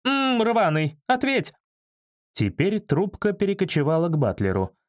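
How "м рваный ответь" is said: high, bleeped-out demand; irate woman yelling, pitched up; angry yelling woman. A narrator's reading. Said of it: "заорала Валентина".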